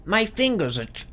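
strained high voice reading a journal; hurtful character voice. Pretend. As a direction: push the voice up, it hurts. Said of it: "It's gonna hurt with this voice".

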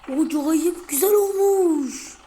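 loud, high, drawn-out cheer; excited admiration. Real, not acted. Gamer admires his modified van, excited drawn-out cheer. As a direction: stretch it out in delighted awe.

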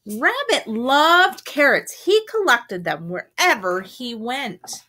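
animated, emphatic storytime narration; story read to children. A narrator's reading. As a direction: read it to young children, punching key words. Reads a picture book aloud.

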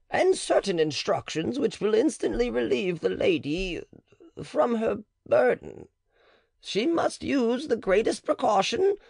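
high-pitched wary midwife; the matron. Acted. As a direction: hedge and set your terms. Matron voiced in the dialogue.

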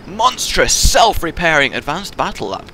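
ordinary talk, nothing marked

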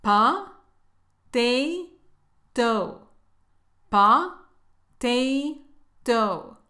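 "Pa", "te", "to" as puh, tay, toh, separately; teacher spacing "Pa", "te", "to" apart; syllable breakdown. Shown as a demonstration. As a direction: sound out "Pa, te, to" with pauses.

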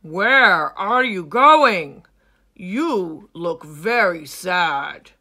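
put-on loud, booming old lion; old lion Claw. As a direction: answer with a lion's weight. Claw asks Hilmi.

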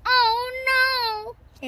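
high, drawn-out wail of dismay. A narrator's reading. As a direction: cry out at the disaster. Reacting to the machine crash.

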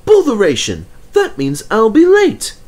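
high, loud, impatient engine; annoyed engine. Acted. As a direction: huff it out in a high voice. Thomas annoyed.

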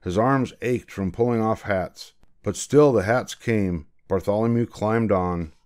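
ordinary delivery

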